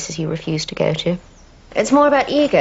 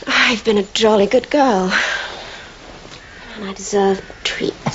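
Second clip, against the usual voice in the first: put-on posh accent. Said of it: "that posh accent gets me every time".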